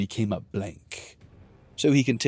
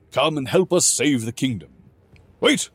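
booming warrior king; 5 semitones higher, 7 dB louder than usual. Acted. Animated king.

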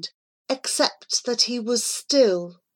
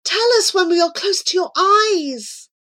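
high, crying plea; begging; 11 semitones higher, 4 dB louder than usual. A narrator's reading. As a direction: beg at a raised pitch, desperately. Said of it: "he begged".

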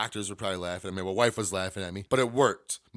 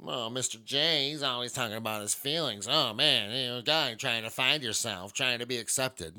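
lightly mock the people who judge him; mocking critic. Podcaster mimicking listeners who mock his feelings, sneering lightly.

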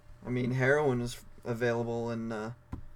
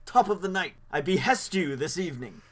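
high Victorian gent greeting; old-timey gentleman voice; 5 semitones higher than usual. Acted. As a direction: greet like a shady 1800s gentleman. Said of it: "Sounds more like a guy that's going into a, uh, opium den".

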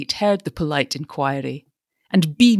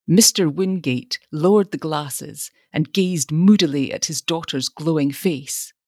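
usual speaking voice

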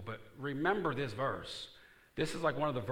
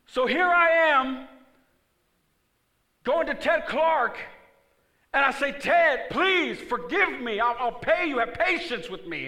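high, loud, slow begging; loud preaching plea; pleading cry; 15 dB louder, slower than usual. Pretend. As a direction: beg your creditor at full volume. Preacher acting himself as debtor.

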